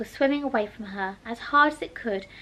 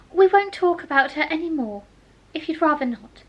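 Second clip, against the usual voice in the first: Alice's voice; 5 semitones higher, 6 dB louder than usual. Acted.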